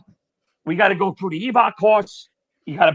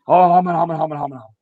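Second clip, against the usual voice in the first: mock stammering, trailing lower; flustered stammer; 3 semitones lower, faster than usual. Firefighter brushing off the instructor's question, mock flustered stammer. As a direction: mimic a tongue-tied, hemming reply.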